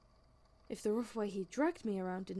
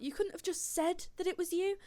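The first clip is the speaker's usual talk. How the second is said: raised, exasperated complaint; indignant rant; 5 semitones higher, 4 dB louder than usual. Acted. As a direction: tell him off in outraged disbelief. Angrily confronts the deceiving colleague.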